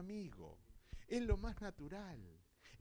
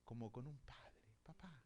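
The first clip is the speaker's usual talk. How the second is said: hushed, intimate whisper; 4 semitones lower, 12 dB quieter than usual. Real, not acted. Pastor tells the congregation of closeness to God, whispered.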